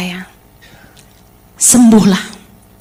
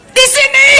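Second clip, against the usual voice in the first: high little hurt voice, pointing to her heart; hurt wife's reply; 12 semitones higher than usual. Retold or imitated. Wife answering her husband, high and hurt.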